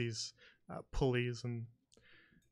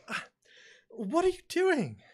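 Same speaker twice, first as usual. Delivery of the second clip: high, loud, drawn-out startled cry; startled shriek; 7 dB louder than usual. Acted. Woman stepping back from the waiter immediately, shrieking in alarm. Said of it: "a quick little shriek and just steps back".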